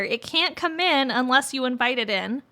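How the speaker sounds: normal talking voice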